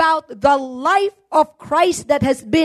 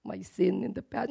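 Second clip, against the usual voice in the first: lower, much softer worried murmur; hushed imitation of a nervous churchgoer; mock anxious believer; 7 semitones lower, 13 dB quieter than usual. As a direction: voice a timid believer fretting over old sins. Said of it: "you're not like".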